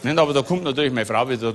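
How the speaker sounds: usual speaking voice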